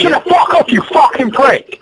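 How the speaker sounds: high, strained angry yell; real rage at a heckler, pitch shooting up; furious outburst. Real, not acted. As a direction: scream the insult, dragging out the end. Raging at a mocking rival.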